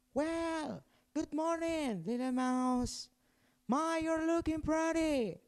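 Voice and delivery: very high, soft little mouse; Mister Mouse flirting with the little mouse, squeaky and soft. Acted. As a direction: squeak it gently like a tiny suitor.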